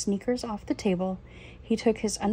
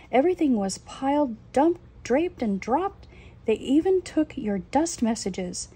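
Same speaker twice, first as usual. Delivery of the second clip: high, loud urgent alarm; alarmed shouting; 6 semitones higher, 5 dB louder than usual. Acted. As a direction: wake him up in a panic. Father wakes son, alarmed.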